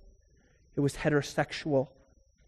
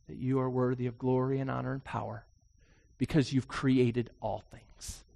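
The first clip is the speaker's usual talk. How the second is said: closing prayer; 3 semitones lower than usual. Acted. Pastor leads closing prayer.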